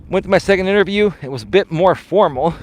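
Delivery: ordinary delivery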